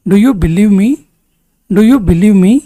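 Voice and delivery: ordinary talk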